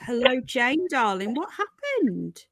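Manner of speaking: normal talking voice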